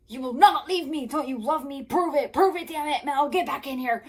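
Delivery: high, very loud demanding yells; screaming. Acted. Jared demanding Melanie come back, full hammed-up screaming. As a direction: scream each demand right into an ear, hammed up. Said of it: "screaming right in her ear".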